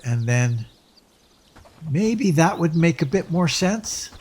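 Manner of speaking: ordinary delivery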